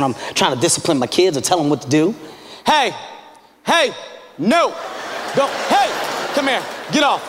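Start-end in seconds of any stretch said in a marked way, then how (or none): 2.7-7.2 high, sharp bursts with pauses between; exasperated dad scolding. Acted. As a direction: scold unruly kids, losing patience as you go. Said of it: "I really get upset".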